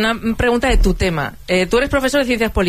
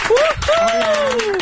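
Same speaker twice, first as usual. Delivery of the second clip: high, sustained whoop; excited whoop. Real, not acted. Cheering a guest's introduction, high excited whoop. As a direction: let out a long, high cheer.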